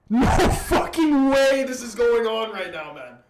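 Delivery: laughing in loud disbelief; disbelieving laugh. Real, not acted. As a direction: shout it, half laughing. Still reeling from the goal.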